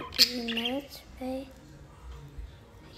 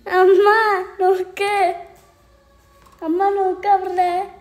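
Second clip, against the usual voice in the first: loud high call to mother; 4 semitones higher, 10 dB louder than usual. Real, not acted. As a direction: shout across the house for mama. Calling out loudly.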